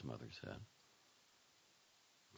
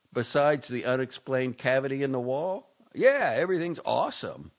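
louder, high sarcastic reply; 7 semitones higher, 8 dB louder than usual. A narrator's reading. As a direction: drip with sarcasm.

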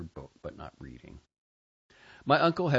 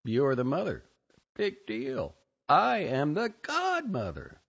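higher, louder, scornful and proud; defiant inner voice; 6 semitones higher, 4 dB louder than usual. Retold or imitated. Bartonella self defying the sister, high scornful boast. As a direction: brush her off, then crown yourself. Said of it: "Bartonella me said".

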